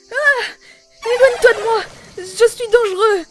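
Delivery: loud, high, terrified sobbing; panicked crying shout. Acted. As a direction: scream it through tears. Wakes from a nightmare in panic.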